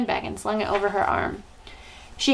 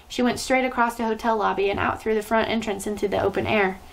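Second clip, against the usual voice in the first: plain reading voice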